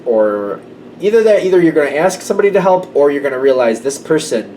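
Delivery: ordinary talk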